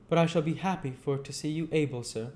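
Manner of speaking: simple man, a little stiff and deferent; kindly Joe voice. Acted. Joe answers Pip.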